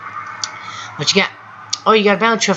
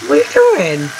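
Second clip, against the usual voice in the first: startled outburst; 11 semitones higher, 3 dB louder than usual. Real, not acted. Teammate suddenly flies at him.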